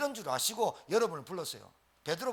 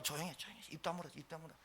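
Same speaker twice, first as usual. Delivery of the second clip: much softer, urgent shushing; hushing; 3 semitones lower, 14 dB quieter, faster than usual. Retold or imitated. Jesus hushing Peter to keep quiet, much softer. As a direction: hush him down quickly.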